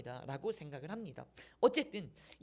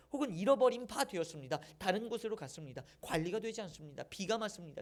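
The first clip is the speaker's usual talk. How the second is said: ordinary talk, nothing marked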